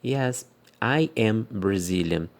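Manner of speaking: slow, careful full answer; slow teacher demo of a long answer; long answer, slowly. Shown as a demonstration. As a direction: spell out the whole answer slowly. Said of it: "uma resposta um pouco longa".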